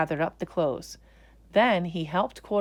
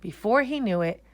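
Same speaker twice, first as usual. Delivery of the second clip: ordinary delivery, nothing marked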